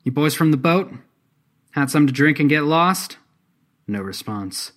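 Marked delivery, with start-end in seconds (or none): 0.0-3.2 raised, higher challenging voice; loud challenge. A narrator's reading. Artemis questions the silent men, loudly challenging. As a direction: call out to strangers across the clearing. Armed challenge to unresponsive strangers.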